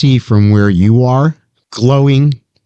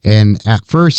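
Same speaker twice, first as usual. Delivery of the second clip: usual speaking voice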